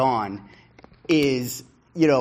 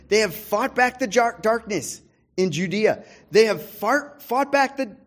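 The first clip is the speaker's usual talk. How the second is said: high, loud fervent sermon delivery; full-force preaching; 6 semitones higher, 4 dB louder than usual. Acted. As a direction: drive each clause harder like a preacher on fire.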